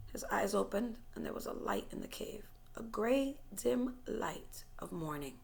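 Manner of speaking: soft, breathy hush as he wakes; barely voiced, drifting out of sleep; hushed waking. A narrator's reading. Narrator hushing as Brian wakes in the cave, soft.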